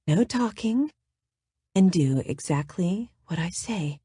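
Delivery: soft, breathy command; breathy, sexy. Acted. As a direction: purr the order quietly. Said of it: "the breathy, sexy voice she'd used on the phone".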